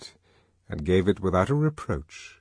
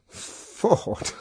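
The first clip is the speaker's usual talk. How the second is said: airy exclamation tipping into a chuckle; acted amused outburst; laughing; 10 semitones higher, 3 dB quieter than usual. A narrator's reading. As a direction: gasp the oath out, then laugh. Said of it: "He laughed again".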